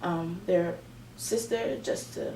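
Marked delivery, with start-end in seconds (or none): none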